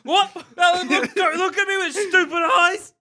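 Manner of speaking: mock scolding a sheep. Player scolding a petting-zoo sheep, high mock voice. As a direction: tell off the staring sheep, high.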